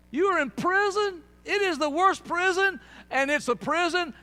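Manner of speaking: very loud, high, emphatic shout; preaching shout. Real, not acted. Preacher warning people they are in prison, shouted. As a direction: hammer each phrase at full force. Same words repeated at full force.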